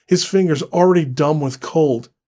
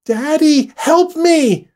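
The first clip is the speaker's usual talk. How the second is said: put-on high, loud child's cry; a little girl screams for her father; child crying for help; 13 semitones higher, 7 dB louder, slower than usual. A little girl cries out to her father for help, loud and high. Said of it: "Livy's voice".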